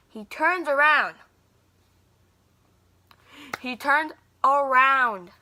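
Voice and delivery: TURNS AROUND drawn out slow and loud; dramatic reveal. Real, not acted. Vlogger building up to the celebrity reveal, dramatic slow emphasis. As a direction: stretch out "turned around", loud and slow for suspense.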